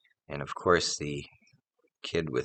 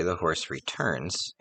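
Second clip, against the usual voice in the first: ordinary talk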